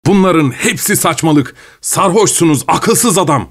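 mild shout. A narrator's reading. Vilchanov scolds the drunken Pavel, mildly shouting. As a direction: raise the voice in indignation, short of yelling. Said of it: "diye bağırdı Vilchanov her zamankinden daha yüksek sesle".

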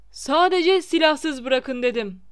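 loud, slow shout of a teacher in horror; horrified shout. A narrator's reading. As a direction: yell over the crowd, appalled. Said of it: "diye dehşet içinde bağırdı". (dehşet içinde, yavaş ve yüksek sesli bağırış)

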